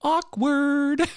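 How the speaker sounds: high, loud drawn-out awkward; sing-song cringing awkward; mock awkward. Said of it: "I was like".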